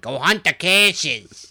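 mock New York accent, loud and slow; hammed-up New York accent. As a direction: call it out like a New Yorker. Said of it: "I hate the New York accent".

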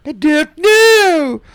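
very high, drawn-out loud wail; mock it loud, high and stretched right out; slow, shrieky mock echo; exaggerated mimicry. Co-host mockingly mimics the answer.

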